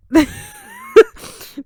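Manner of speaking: high, loud burst of laughter; high squealing laugh. Real, not acted. Vlogger giving up on the French twister, shrieking laugh.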